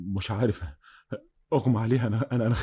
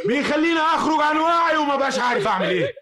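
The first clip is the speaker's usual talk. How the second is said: manic laugh; 13 semitones higher, 3 dB louder than usual. Acted. Killer laughing manically at her tears.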